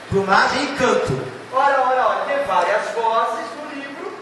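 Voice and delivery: usual speaking voice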